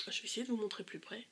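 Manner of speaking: fast whisper to herself; whispered aside. Real, not acted. Bringing the brush closer to the camera, whispered. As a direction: mutter it under the breath while busy.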